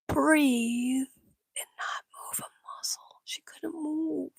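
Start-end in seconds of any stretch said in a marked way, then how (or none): none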